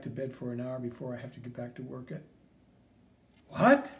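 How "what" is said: sharp, high, loud burst; jolted awake by the news; shocked. Real, not acted. Mother seeing her son on TV, shocked.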